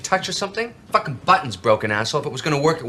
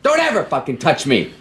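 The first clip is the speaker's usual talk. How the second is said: threatening; 8 semitones higher, 4 dB louder than usual. Acted. Bernie threatens the others.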